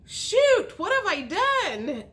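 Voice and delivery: sudden high loud yelp of dismay; mock panic. Gemini realizing it must move out, high mock panic. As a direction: blurt it out in alarm.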